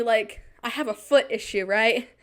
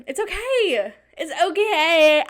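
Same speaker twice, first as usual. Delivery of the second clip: shaky self-reassurance; 6 semitones higher, 6 dB louder, slower than usual. Real, not acted. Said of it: "Yeah, I'm really scared".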